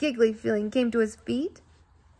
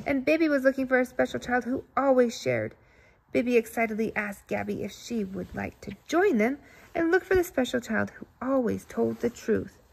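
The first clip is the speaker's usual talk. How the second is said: ordinary delivery, nothing marked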